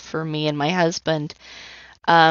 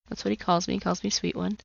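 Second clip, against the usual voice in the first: normal talking voice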